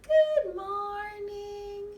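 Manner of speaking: high, slow sung greeting; Bella's voice. Pretend. Girl puppet greeting in a high slow voice. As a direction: sing it sweetly as the girl puppet. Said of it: "She says".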